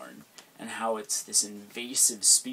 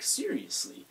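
ordinary delivery

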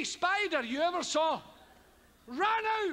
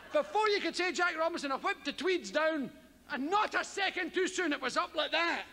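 usual speaking voice

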